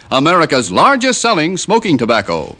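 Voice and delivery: ordinary delivery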